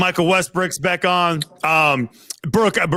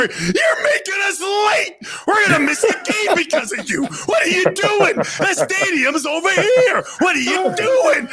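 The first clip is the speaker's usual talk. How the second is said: retold angry yell. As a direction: shout it like a frantic player yelling. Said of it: "he says to the bus driver".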